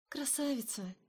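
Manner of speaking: breathless awed whisper; spellbound whisper. A narrator's reading. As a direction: whisper it, enchanted. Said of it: "заворожённо прошептала".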